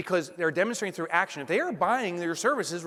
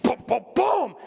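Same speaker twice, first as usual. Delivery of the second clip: bass imitation; 7 semitones higher, 6 dB louder than usual. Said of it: "It's a bass".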